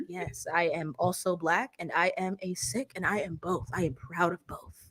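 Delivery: low, slow, soft formal delivery; mock a careful interviewee, slowly and deliberately; teasing imitation of a measured interview voice; Ray's interview voice. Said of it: "I've never heard Ray speak like that".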